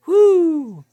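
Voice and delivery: very high, loud hooting whoop. Retold or imitated. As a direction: whoop out like a gawking bystander. Bystanders whooping at the stink.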